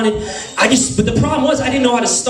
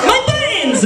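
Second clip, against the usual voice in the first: panicked shriek; 11 semitones higher than usual. Retold or imitated. Boy to girl ripping his shirt, high panicked cry. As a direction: wail it out high like a stage line. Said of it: "Like I was in a Broadway play".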